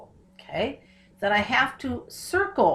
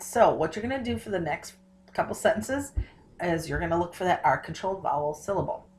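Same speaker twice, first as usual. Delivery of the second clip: ordinary talk, nothing marked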